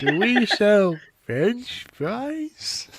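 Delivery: high, very slow muffled voice; comic muffled intercom; mock garbled intercom. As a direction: drag it out like a bad speaker.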